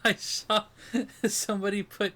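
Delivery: high, breaking into laughter; laughing. Real, not acted. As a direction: keep giggling through the restart. Cracking up while starting a story.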